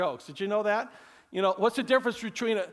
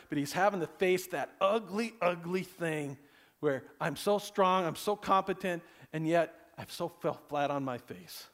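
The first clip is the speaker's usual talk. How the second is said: usual speaking voice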